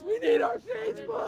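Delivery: high, loud street-crowd yell; mock protester shout. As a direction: bellow it like a desperate protester. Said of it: "People would just be in the streets".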